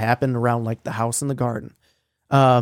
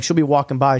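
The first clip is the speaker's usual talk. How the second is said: usual speaking voice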